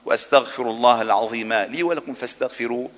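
ordinary talk, nothing marked

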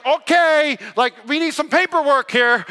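high, loud mock panic; flustered. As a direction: act out nervously stalling. Acts out his flustered reaction.

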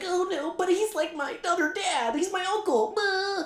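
high mock whine; whiny baby voice. Friend mocks Danny Rand's uncle excuse as a high whiny baby. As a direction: whimper it like a big baby. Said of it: "a whiny baby man".

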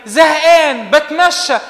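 loud, high mock bored youth. Preacher mimics a bored youth to the congregation, loud and mocking. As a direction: play the bored young man, drawn out.

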